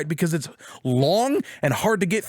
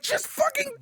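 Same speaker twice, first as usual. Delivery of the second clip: exasperated outburst; 4 dB quieter than usual. Retold or imitated. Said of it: "I'm like".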